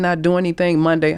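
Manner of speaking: ordinary talk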